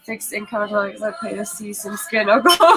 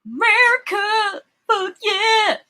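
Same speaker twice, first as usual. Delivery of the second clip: patriotic shout; 10 semitones higher, much slower than usual. Acted. Patriotic cheer echoed.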